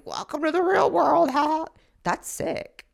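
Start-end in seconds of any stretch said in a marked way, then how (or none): 0.0-1.6 high-pitched mocking imitation; exaggerated high sneering mimicry; mocking old naysayers. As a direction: mimic smug repliers in a high put-on voice. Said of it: "these old hoes that are replying".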